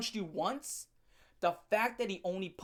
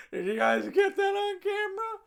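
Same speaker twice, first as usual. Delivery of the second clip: high shouted mock of the guy; mocking quote; 12 semitones higher, 4 dB louder than usual. Vlogger mocking the guy filming himself, high shouted mock. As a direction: yell it like a show-off fishing for an audience. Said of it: "you was looking at those women like".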